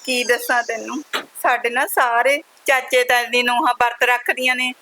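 a widowed daughter sobbing out her sorrow to her mother; tearful lament. Acted. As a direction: pour out her grief on the edge of tears. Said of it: "ਰੋ ਨਾ।".

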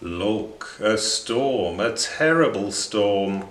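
ordinary delivery, nothing marked

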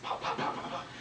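quick-fire whispery pops; fast strike sounds. Shown as a demonstration. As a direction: rattle off soft, voiceless pops. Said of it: "that will be done fast".

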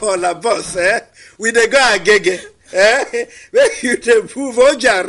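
loud, laughing Yoruba bus conductor; Yoruba accent. Shown as a demonstration. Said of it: "My Yoruba accent is so flawed".

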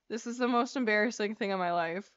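ordinary delivery

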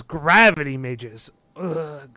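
loud, slow disgusted complaint; irritated, emphatic grousing; glaring grumble. Acted. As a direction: say it with a glare and an ugh. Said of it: "Ugoth kinda glares".

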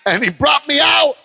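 preacher's shouted praise; shouting praise. Real, not acted. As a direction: cry it out in triumph. Testimony climax.

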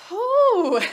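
high, loud blown-out whew; puffing out a tired whew; winded whew. Real, not acted. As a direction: blow out hard after the effort. Winded mid-workout exhale.

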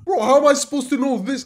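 exasperated protest. Real, not acted. Protesting a hard question.

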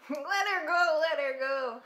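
high loud pleading shout; pleading yell. Real, not acted. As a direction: beg the screen to let her go.

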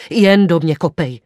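loud, impassioned dare; crying out. A narrator's reading. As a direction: burst out, begging for it. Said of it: "vykřikl jsem".

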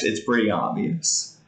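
ordinary delivery

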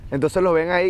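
ordinary talk, nothing marked

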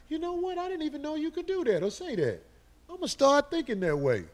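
high-pitched voice of an impressed viewer; imagined viewer reaction. Acted. Viewers watching him react to his ideas, high and impressed. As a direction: quote an amazed fan, pitched well up.

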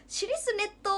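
high, teasing repeat of a silly word; high mock question; squeaky mock query. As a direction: toss the odd word back in a squeaky voice. Repeating odd word, amused.

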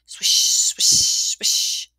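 loud breathy sweeping sounds; whispered swish noises. Acted. Narrator imitating the broom on stone steps, loud whisper. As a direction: hiss out each swish like a broom stroke.

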